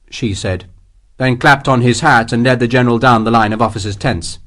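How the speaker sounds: ordinary delivery, nothing marked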